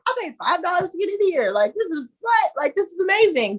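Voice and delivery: high and bright with disbelief; amazed disbelief. Retold or imitated. Retelling his thrill at the cheap entry, high excited gush. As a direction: marvel at getting in for five dollars. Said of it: "And I'm like".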